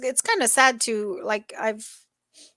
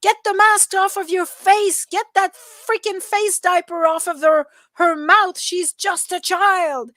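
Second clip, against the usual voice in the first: high, loud mock outrage; imitated yelling; 8 semitones higher, 5 dB louder than usual. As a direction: yell it like an indignant stranger scolding a shopper. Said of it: "some dude just started yelling at her".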